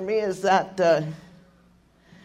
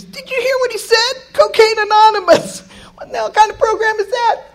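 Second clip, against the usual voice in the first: put-on much higher, sneering young woman; mocking girl's voice; 4 dB louder than usual. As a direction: imitate the girl scoffing at the program. Girl in the elevator mocking.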